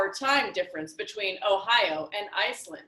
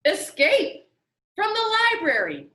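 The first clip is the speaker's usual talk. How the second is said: obvious answer; 8 semitones higher, 5 dB louder than usual. Acted. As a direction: make it sound self-evident. Lemoncello stating the obvious.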